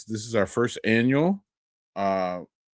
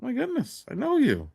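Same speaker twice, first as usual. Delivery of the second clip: mock surprised greeting, pitched much higher; excited reunion; 14 semitones higher than usual. Retelling bumping into Caleb at a brewery, excited. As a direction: play two old acquaintances spotting each other. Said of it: "we're just like".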